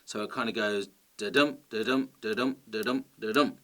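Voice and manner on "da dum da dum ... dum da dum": da-dum chanted in five even beats, stress on each "dum"; "da-dum" in a steady unstressed-stressed rhythm; iambic beat. Shown as a demonstration. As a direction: tap out "da-dum" with a light "da" and a strong "dum". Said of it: "this pattern in poetry that has five beats per line".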